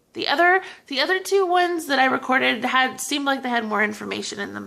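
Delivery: ordinary delivery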